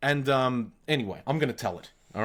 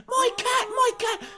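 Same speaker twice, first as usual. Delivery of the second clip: very high falsetto shriek; hammed-up high-pitched wail; mock screaming woman. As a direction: imitate a distraught cat owner screaming. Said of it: "she comes screaming at us".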